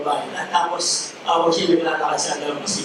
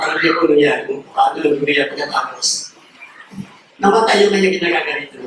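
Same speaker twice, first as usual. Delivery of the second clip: ordinary talk, nothing marked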